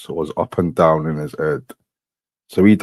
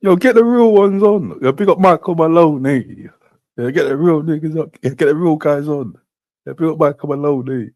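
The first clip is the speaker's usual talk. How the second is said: hype shout; 10 semitones higher than usual. Real, not acted. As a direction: shout it out like hyping the crowd. Hyping the real ones, calling out.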